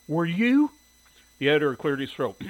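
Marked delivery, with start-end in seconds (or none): 0.1-0.7 high, loud, rising challenge; challenging retort. A narrator's reading. Shirley retorting to the editor, challenging. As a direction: throw the question right back.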